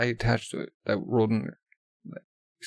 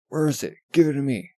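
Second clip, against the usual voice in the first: higher and louder, barking the demand; angry shout; 3 semitones higher, 7 dB louder than usual. Acted. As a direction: shout it in her face. Villain repeats demand, shouting.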